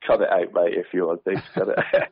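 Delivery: can't stop laughing, apologising to the co-host; laughing apology. Real, not acted. Presenter cracks up on air.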